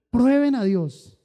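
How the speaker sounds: loud, slow, emphatic command; preacher urging the faithful; forceful exhortation. Acted. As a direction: drive it home to the flock.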